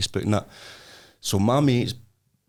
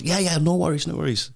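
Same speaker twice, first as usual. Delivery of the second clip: a hammed-up American accent, a bit higher; 7 semitones higher than usual. Storyteller doing the American guy's American accent. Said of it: "That was my American accent there".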